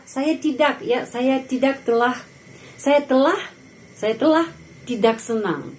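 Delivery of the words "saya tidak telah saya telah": ordinary talk, nothing marked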